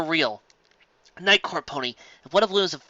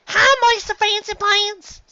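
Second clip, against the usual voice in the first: very high, loud, drawn-out call; 8 dB louder, much slower than usual. A narrator's reading. Apple Bloom calling to Fancy Pants, high and drawn out. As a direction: call across to him like an eager kid. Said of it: "called Apple Bloom".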